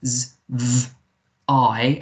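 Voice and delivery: "z, v, I" as three separated sounds; "z, v, I" with gaps, ending on "eye"; sounded out. Shown as a demonstration. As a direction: buzz "z" and "v", then open to "I". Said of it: "is a v sound".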